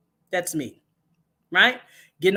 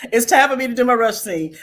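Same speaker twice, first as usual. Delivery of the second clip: laughing; 4 semitones higher, 6 dB louder than usual. Real, not acted.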